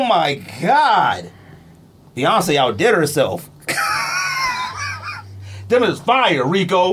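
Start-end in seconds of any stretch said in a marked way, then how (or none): 3.7-5.6 high shrieking burst of laughter. Real, not acted. Cracking up over dipped sneakers.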